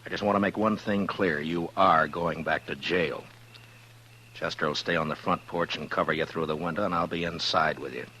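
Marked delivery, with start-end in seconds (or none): 1.4-3.2 slow and firm, leaning hard on "are"; stress on ARE. Acted. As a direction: spell it out slowly, punching "are" so he can't argue. Marshal warning prisoner firmly.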